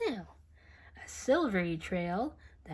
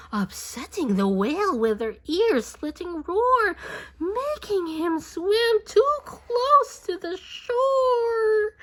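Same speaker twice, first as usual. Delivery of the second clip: louder and higher as the boats roar; excited, rising alarm; 9 semitones higher, 8 dB louder than usual. A narrator's reading. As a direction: build the danger until it cries out. Speedboat chaos narration.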